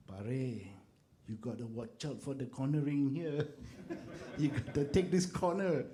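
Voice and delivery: imitating Filipino accent, laughing, high; light Filipino accent, loud and amused. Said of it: "in his Malaysian, uh, Filipino accent".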